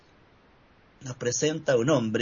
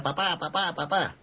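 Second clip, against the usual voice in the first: lightly voiced child calling for papa, slightly higher; child crying out; 4 semitones higher than usual. A child's voice rises from the crowd, mild imitated cry. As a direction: imitate a small boy's call, without real shouting. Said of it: "una voz infantil que grita".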